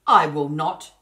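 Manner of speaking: put-on boy defiantly refusing the sorcerer; defiant cry. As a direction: shout him down. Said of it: "cried Hugh".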